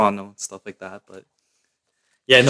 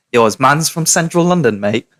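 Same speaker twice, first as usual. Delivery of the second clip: London roadman slang, higher pitched; london roadman accent; 4 semitones higher, 4 dB louder than usual. Pretend. Mocking a London viewer's slang.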